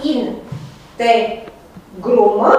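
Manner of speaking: in, te, grumă with a pause after each syllable; split into syllables. Shown as a demonstration. Teacher dividing the word for students, syllable by syllable. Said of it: "În exemplul pe care l-am dat".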